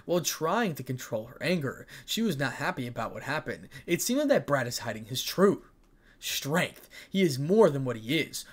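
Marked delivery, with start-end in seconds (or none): none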